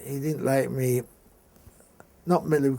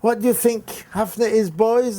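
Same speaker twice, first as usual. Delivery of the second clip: higher, louder sneering question; mocking teacher; 8 semitones higher, 7 dB louder than usual. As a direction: jab the question at him. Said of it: "he would say".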